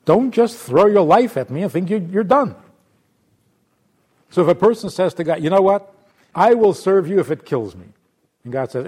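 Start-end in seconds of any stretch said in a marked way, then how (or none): 0.1-2.5 loud scolding, pitched up; scolding God voice. Acted. God rebuking the martyr, loud and raised. 5.4-7.9 loud earnest vow; mock vow. Person vowing to God, loud.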